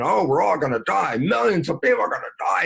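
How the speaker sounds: high, fast, hammed-up doom-monger; mock media panic. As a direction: parody the alarmist news, quick and high. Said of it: "all the media has been".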